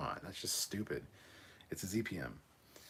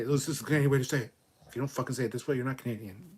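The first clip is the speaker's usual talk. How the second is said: fast, higher, loud mock dogmatism; mock insistence; 5 semitones higher, 8 dB louder, faster than usual. Streamer mimics gatekeepers of Canadian speech, mock insistence. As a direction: parody someone laying down the law, rushed. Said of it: "you can't say".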